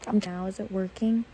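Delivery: ordinary talk, nothing marked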